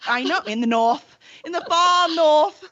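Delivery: a hint of an English accent, drawn out; lightly slipping into a British accent on the far North; mock English accent. Joking about Canada, drifting into a mild English accent. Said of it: "I don't know why I have an, a, an English accent".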